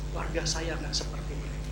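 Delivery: hushed, low voice of a moved man; retold sad confession. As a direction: quote him softly, dropping right down. Quotes a man moved to tears.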